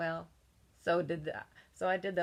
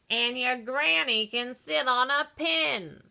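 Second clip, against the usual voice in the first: mock pig yell; 5 semitones higher, 6 dB louder than usual. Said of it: "that third little pig yelled".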